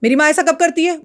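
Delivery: half-hearted imitation of a yelling husband; retold yell. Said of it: "चिल्ला के बोलता".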